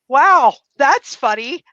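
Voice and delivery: amused surprise. Retold or imitated. Finding shoes and no dresses in the box, amused wonder. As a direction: savour the absurd surprise.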